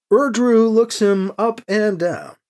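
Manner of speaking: plain reading voice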